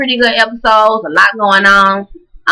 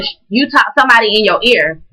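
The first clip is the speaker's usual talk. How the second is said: usual speaking voice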